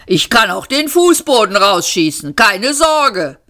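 rampaging machine showing off; blustering boast. A narrator's reading. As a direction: boom it out, eager to destroy.

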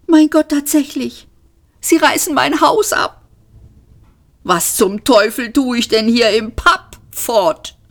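hoch und aufgebracht, fast schreiend; empört über das eigene Haus; wütend entsetzt. Acted. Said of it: "sah sich wütend um". (wütender, hoher Ausruf)

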